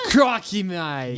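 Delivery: slow, stretched Australian catchphrase; mock Australian accent. Joking about Australians with friends, mock Australian accent. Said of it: "Australian accent is just a British accent".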